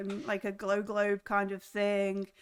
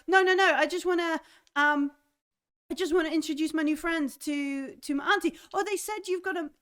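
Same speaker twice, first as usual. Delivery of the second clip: put-on higher, eager young voice; keen to introduce friends, bouncing along; eager Ninjak; 7 semitones higher, faster than usual. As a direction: Ninjak eagerly asking to see his auntie first.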